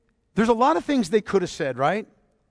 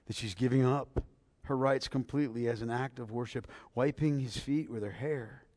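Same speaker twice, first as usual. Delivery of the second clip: quiet, lower, tender preaching; soft devotional tone; 8 semitones lower, 12 dB quieter than usual. Real, not acted. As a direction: speak it gently as worship. Preacher softly builds devotion.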